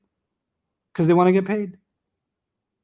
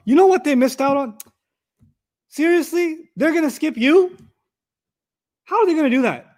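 higher, outraged on a friend's behalf; indignant friend; 8 semitones higher than usual. Pretend. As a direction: protest like a loyal friend who can't believe it. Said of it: "I'm your friend, I'm mad".

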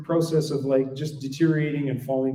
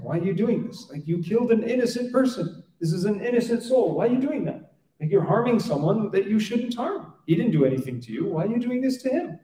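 higher, pained protest against the killing; upset protest; 7 semitones higher than usual. Retold or imitated. Musa confronting the man over the boy, high upset protest. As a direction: plead in disbelief, pitch climbing high. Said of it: "he gets upset".

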